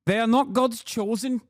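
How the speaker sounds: clumsy attempt at South African accent; bad South African accent. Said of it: "No, that's f-, awful".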